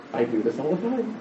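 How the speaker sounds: soft, sobered confession; quiet realisation. Retold or imitated. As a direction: drop right down, as if thinking it privately. Sudden realisation, first take subdued.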